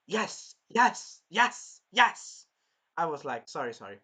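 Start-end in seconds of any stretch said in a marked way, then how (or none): 0.1-2.5 high, loud triumphant yes. Retold or imitated. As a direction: cheer each yes louder. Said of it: "And I was like".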